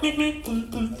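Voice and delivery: high cartoon meep meep; Roadrunner. Elijah fleeing Jezebel as the Roadrunner, high cartoon meep. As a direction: zip off like a cartoon bird. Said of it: "that was a Roadrunner reference".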